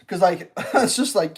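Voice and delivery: ordinary talk, nothing marked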